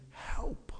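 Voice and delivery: a breathless whispered cry for help; whispered plea. Acted. Preacher voicing the tax collector, whispered plea. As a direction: whisper it like a desperate sinner.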